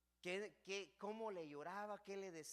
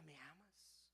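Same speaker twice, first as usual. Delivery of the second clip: much softer, hushed ¿Me amas?; soft question; 3 semitones lower, 16 dB quieter, slower than usual. Acted. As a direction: ask it gently, almost to himself. The Lord asks the third time. (pregunta suave y baja)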